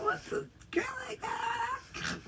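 high, slow, crying mock shout; mock wailing. To friends, mock wailing about [name]. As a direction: wail it like a fake sob.

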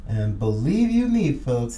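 ordinary delivery

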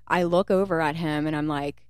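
ordinary talk, nothing marked

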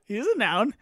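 usual speaking voice

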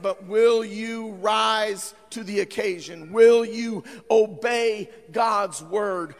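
ordinary talk, nothing marked

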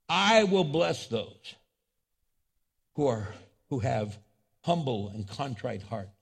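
measured reading with long pauses; solemn scripture reading. Acted. Preacher reads God's words from Isaiah, solemn and measured. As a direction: proclaim each phrase, then let it sink in.

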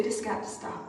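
hushed, calm and steady, nearly whispered. Retold or imitated. Wife quietly telling her drinking husband to stop, calm and hushed. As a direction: soften it right down and keep it calm. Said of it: "in a calm voice".

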